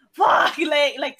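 loud burst of exasperation; exasperated curse. Retold or imitated. Retelling nearly passing out on the bus, exasperated. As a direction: curse out loud, breaking into a laugh.

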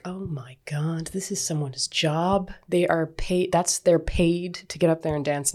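breathless, slow hushed whisper; awed whisper. Retold or imitated. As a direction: whisper it in awe, like a starstruck teen. Awestruck teen on the drive home.

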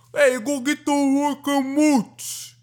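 high, slow, hammed-up New York Italian; mock a New York Italian, stretched out and high. Mocking the New York Italian talk he dislikes, exaggerated accent. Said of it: "New York Italian kind of thing".

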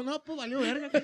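high laughing repeat. Pretend. Repeats line higher, laughing.

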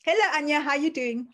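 normal talking voice

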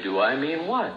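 mock innocent, needling; lightly sarcastic retort; sarcastic deflecting. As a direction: play dumb to sting her. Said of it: "sarcastic routine".

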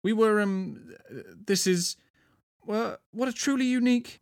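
high, halting stammer; flustered. A narrator's reading. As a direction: fumble for words with awkward pauses. Flustered man stammers at a woman.

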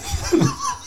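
high laugh breaking out; burst of laughter. Real, not acted. Host laughing at his friend's excuse, real laughter. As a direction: crack up laughing.

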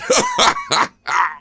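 high-pitched burst of laughter; guilty laugh. Real, not acted. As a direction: laugh at your own slip. Laughs after spoiling the film.

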